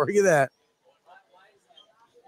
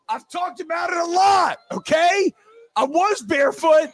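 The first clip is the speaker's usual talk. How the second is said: exasperated rant; 7 semitones higher than usual. Real, not acted. Owning up to the barefoot Vegas night, shouting higher. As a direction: blow up in mock exasperation, yelling.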